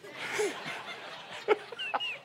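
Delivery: airy laugh rising high; breathless high laugh. Real, not acted. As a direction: let out a silent wheezing laugh. Laughs after story.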